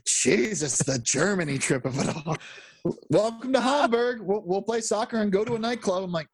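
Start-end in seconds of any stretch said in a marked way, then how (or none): 3.1-6.0 high-pitched mock announcement of the itinerary; mock welcome. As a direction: put on a higher voice, sending up the welcome. Mocking the film's Germany trip plot.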